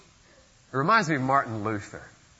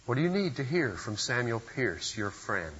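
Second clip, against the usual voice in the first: normal talking voice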